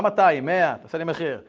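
loud haggling objection. Retold or imitated. The father haggling the price down, loud. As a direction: bargain him down.

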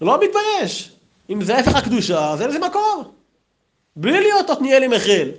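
mock outraged tirade; retold screaming rebuke. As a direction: imitate a rabbi's screamed rebuke. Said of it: "הוא צרח ואומר".

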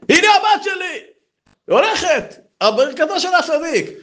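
high, bursting with joy; overjoyed father. Acted. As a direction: announce the miracle. Rich man bursts in with cured daughter.

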